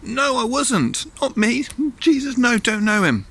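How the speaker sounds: very high, loud indignant denial; hammed-up outraged squawk; vehement oath. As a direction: protest wildly at the top of your voice. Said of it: "calls down an oath, almost like a ... swearing".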